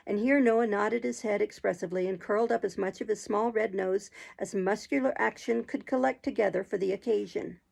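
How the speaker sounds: ordinary delivery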